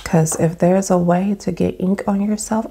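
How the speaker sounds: ordinary talk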